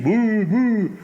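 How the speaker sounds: high, loud crowd boo; loud mock boo. The audience demanding an encore, loud boos. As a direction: call it out like a chanting audience. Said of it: "they scream".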